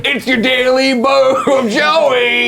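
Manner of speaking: slow, drawn-out high announcer. Pretend. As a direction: announce it like a show segment. Announcing the daily boo.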